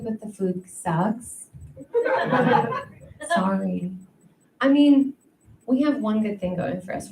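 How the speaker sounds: usual speaking voice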